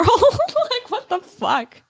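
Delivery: shocked laugh, pitch jumping up; laughing disbelief. Real, not acted. Reacting to the million-dollar debt with a high laughing shock. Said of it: "a million dollars is kind of a lot".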